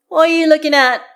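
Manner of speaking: high, loud yelled challenge; yelling. A narrator's reading. Karlach calling to Astarion across the ice, yelling. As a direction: yell it out at full volume. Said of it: "she yells".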